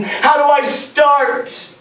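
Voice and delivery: high, slow, exasperated cry; frustrated yell. Retold or imitated. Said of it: "I yelled and spat in my frustration".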